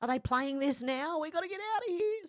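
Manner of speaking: high, fast imitation of a scared child; panicked kids. Recalling two scared kids in the cinema, high panicked imitation. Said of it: "we were both in tears going".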